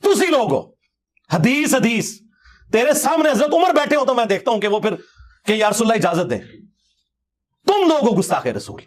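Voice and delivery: normal talking voice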